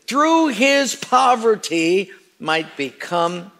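emphatic, drawn-out scripture reading; slow, grand congregational scripture reading; theatrical reading. Shown as a demonstration. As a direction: read it out theatrically, lingering on key phrases. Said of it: "with your best theatrical voice".

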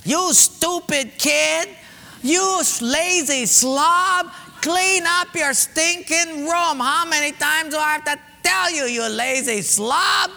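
high, slow mock yelling at a kid; hammed-up scolding parent shouting; angry parent. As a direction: parent yelling at child about filthy room, mock furious. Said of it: "they'll just kind of blurt out".

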